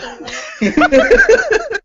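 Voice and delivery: loud, high laugh; burst of laughter. Real, not acted. Player laughs at the cop scene.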